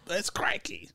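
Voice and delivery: badly faked Australian accent; clumsy Australian accent attempt. Shown as a demonstration. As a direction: try to sound like an outback Aussie. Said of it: "Can you do an Australian accent".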